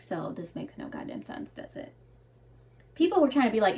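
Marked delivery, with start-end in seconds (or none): 0.0-1.9 soft, breathy, under the breath; a dry unvoiced grumble to yourself; muttered aside. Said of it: "And I'm just like".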